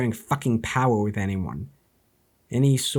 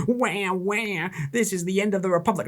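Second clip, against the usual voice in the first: mock whining; 4 semitones higher than usual. Mocking whiny opponents.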